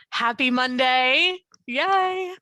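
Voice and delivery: cheery greeting. Real, not acted. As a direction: greet the group with a little hooray. Cheery greeting opening her update.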